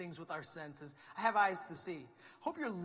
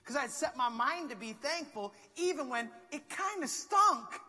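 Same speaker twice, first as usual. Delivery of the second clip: emphatic shout; 7 semitones higher, 6 dB louder than usual. Acted. Preacher's climactic point.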